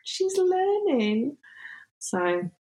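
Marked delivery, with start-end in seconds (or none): 0.0-1.4 high, drawn-out gushing squeal; proud cooing. Retold or imitated. Mum reacts to daughter learning Russian, proud cooing. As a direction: coo it slowly, way up high.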